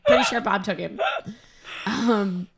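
normal talking voice

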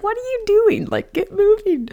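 high, loud mock impatience; playful impression of God fed up; exasperated. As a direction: hurry them up, half laughing. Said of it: "which I think is funny too".

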